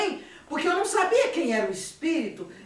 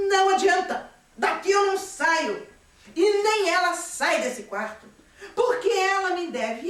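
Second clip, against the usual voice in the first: louder, higher stubborn refusal; a raised, defiant voice; defiant spirit; 5 semitones higher, 4 dB louder than usual. Retold or imitated. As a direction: dig in and refuse to budge. Obsessing spirit refuses to leave.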